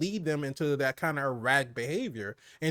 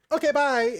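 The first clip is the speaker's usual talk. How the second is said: high, louder, sudden cheery sign-off; breezy goodbye; 11 semitones higher, 5 dB louder than usual. Retold or imitated. Imitating the villain flipping from threatening to carefree, breezy and high. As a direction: toss out a playful, high-pitched farewell. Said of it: "just be like".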